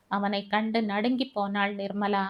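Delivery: ordinary delivery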